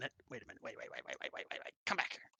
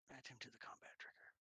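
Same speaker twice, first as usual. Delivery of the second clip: whisper; 17 dB quieter than usual. Real, not acted. Muttering to himself.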